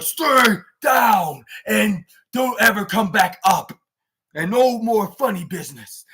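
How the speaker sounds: loud, slow, grunting accent impression; mock deep-accented wrestler, shouting slowly; Pac. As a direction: grunt it out as a drawn-out, commanding shout. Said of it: "a deep accent, his deep, uh, grunting ac-, accent".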